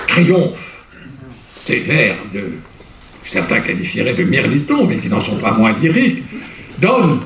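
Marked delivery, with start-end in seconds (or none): none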